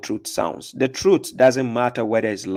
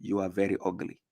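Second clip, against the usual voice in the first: much softer, close to her ear; soft and gentle insult; said gently; 5 semitones lower, 11 dB quieter than usual. Shown as a demonstration. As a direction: murmur it right beside her. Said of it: "say it gently".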